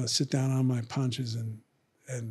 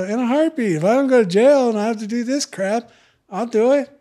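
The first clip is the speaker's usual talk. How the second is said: eager; 8 semitones higher, 5 dB louder, faster than usual. Retold or imitated. Eager retold answer, high and loud.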